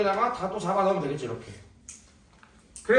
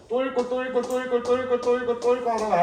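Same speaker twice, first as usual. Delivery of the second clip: panicked student; 6 semitones higher than usual. Shown as a demonstration. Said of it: "내가 극단적 예를 보여줄 거야".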